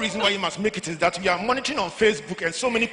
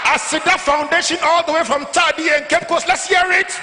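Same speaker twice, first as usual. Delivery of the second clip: loud, high MC call to the crowd; hype call-out; 7 semitones higher, 3 dB louder than usual. Real, not acted. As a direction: shout it out to pump the crowd. MC calling out to crowd.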